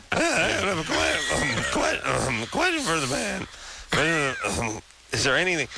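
high, halting teen imitation; mock teenage voice. Host mimicking the young caller's question, mock crazy teenage voice. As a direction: stumble through it like an awkward teenager. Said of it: "easy with that crazy teenage voice".

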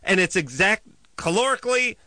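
high-pitched exasperated rant; worked-up rant. Real, not acted. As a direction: rail against it, pitch way up.

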